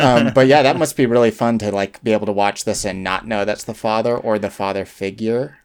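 ordinary delivery

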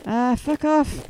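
slow, playful rude farewell; mock rude sign-off. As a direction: toss it off like a cheeky goodbye. Jokey podcast sign-off.

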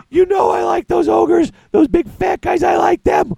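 put-on high, loud childish protest; hammed-up kid pleading for her favourite toys; whiny little daughter. Daughter complaining to dad about sold ogres, high loud childish whine.

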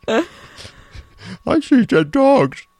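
very high, slow dog voice. Pretend. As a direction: drag it out like a dog talking. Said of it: "That's my dog voice".

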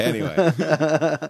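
normal talking voice

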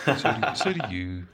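giggling through the words; laughing. Real, not acted. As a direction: laughs while returning the compliment. Laughing back a compliment.

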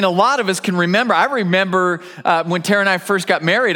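normal talking voice

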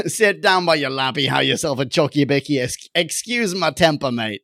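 exaggerated Aussie banter; put-on broad Australian accent. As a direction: play a slangy Australian host. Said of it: "does the best Australian accent".

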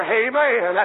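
normal talking voice

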